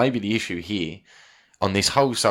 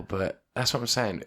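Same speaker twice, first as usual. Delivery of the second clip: normal talking voice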